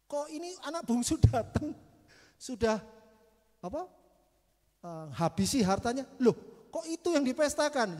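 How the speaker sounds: loud, high incredulous outburst; indignant elder son. Acted. Elder son outraged his brother gets a party, loud and high. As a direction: sputter in outrage at the feast.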